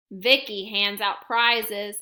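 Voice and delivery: ordinary delivery, nothing marked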